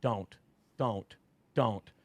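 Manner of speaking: a hushed no, bracing for it; mild dread. Retold or imitated. Father unscrewing the lid, hushed dread. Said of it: "I'm audibly out loud saying".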